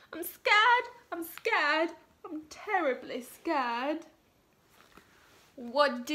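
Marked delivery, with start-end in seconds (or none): 0.1-4.1 high, trembling frightened cook voice; scared cook. Acted. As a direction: squeak it out like a terrified little cook. Scared cook repeats his fear.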